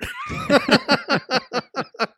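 loud high burst of laughter; big laugh. Real, not acted. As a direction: laugh out hard. Laughing at the memory.

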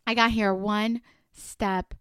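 usual speaking voice

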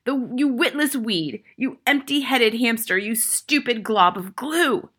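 raging headmistress abuse; Trunchbull bellow. A narrator's reading. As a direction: hurl each insult, slowing on the last. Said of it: "the Trunchbull bellowed".

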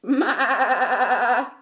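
long wobbling maa; goat bleat. A narrator's reading. Goat answers Duck's hello, bleating. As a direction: bleat like a goat with a strong quaver. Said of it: "said Goat".

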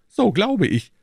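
high-pitched character voice; Aramis. A narrator's reading. Narrator voices Aramis in dialogue. (hohe Figurenstimme)

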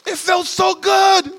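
very high, loud, drawn-out cry; overcome outburst. Acted. Preacher reliving the gift of his Jordans, hammed-up high outburst. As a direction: blurt it slowly, way up high. Hammed-up joy after giving away.

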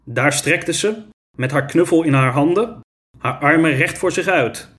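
plain reading voice

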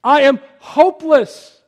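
loud, high-pitched cry of desperation; heart cry. Retold or imitated. Helpless father crying out to God for his daughter, desperate heart cry. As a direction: cry out to God at full voice, slowing into despair. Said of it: "this heart cry".

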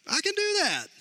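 cocky confidence. Real, not acted. Confident reply to wife's one job.

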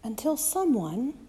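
usual speaking voice

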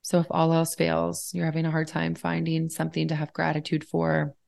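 calm guiding voice with long, unhurried pauses; guided meditation. Real, not acted. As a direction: ease the listener into stillness, leaving space between phrases. Leads a gratitude meditation.

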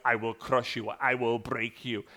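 a hint of a menacing Russian boxer; low, slow villain threat; Ivan Drago. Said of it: "like Ivan Drago from Rocky four".

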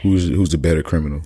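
ordinary talk

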